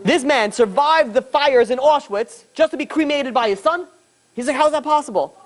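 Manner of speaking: acted plea, pitched well up; distraught outrage. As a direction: draw it out in anguished disbelief. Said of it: "Shimon is going crazy".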